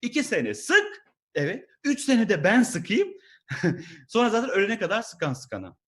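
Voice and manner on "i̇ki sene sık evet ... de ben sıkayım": high, slow mocking boss voice; boss's hidden meaning. Comedian voices what the manager really means. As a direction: spell out the real subtext sneeringly. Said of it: "Onun altında yatan Türkçe metinde şu".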